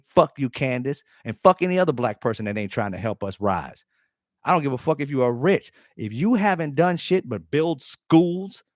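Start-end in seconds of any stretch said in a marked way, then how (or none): none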